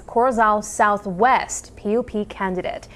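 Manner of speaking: ordinary talk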